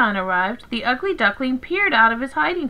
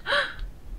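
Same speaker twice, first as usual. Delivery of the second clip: sharp intake of breath; amazed gasp; 10 dB quieter than usual. A narrator's reading. Duckling seeing his reflection, gasping in amazement. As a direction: gasp in sudden wonder. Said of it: "He stared and gasped".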